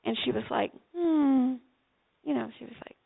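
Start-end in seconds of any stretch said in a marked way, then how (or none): 0.9-1.6 disapproving hmm. Retold or imitated. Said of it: "she wasn't being rude".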